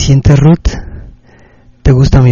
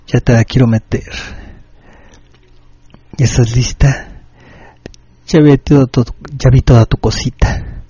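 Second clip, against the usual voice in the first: seductive dirty talk; 3 semitones lower than usual. Acted. Late-night radio host to Ruth, sultry and low. Erotic message to listener.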